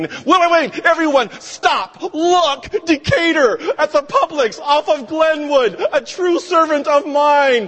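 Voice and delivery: high, loud, excited proclamation; hammed-up announcement to all of heaven; God in heaven. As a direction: shout it out in overblown delight. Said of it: "God in heaven is saying".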